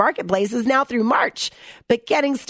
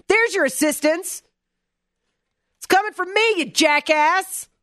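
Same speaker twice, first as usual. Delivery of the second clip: mock angry retort; 7 semitones higher than usual. Host to an imagined dinner guest, mock furious retort. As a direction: snap it back as you throw the coins.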